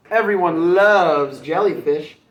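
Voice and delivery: slow, loud, self-satisfied boast; proud. Acted. Jellyfish boasting to Mermaid, slow and proud. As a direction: savour your own name.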